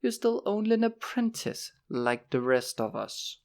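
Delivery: scornful reminder, slowing at the end. A narrator's reading. Will reminding Horace he is an apprentice, scornfully. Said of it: "Will told him scornfully".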